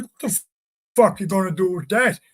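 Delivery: high, startled question; mock panicked retort; retold alarmed disbelief. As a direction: ask it like you just saw the chisel. Quoted alarm at chisel.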